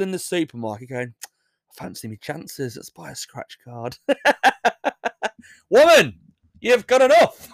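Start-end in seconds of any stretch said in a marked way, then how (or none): 1.2-4.0 sly mumbled aside. Pretend. Imagining the winner going. 5.7-7.5 much higher, much louder exasperated yell; mock scolding shout. Telling the lottery winner off with a mock shout.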